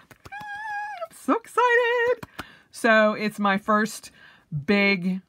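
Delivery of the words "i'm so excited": squealing high with excitement; excited. Real, not acted. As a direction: stretch it out, thrilled. Planner convention trip coming up.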